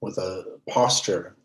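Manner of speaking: measured, settled meditation-teacher voice, leaving space between phrases; guided meditation. Real, not acted. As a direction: guide them unhurriedly into stillness. Leads a meditation.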